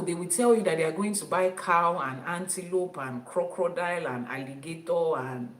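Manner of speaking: ordinary delivery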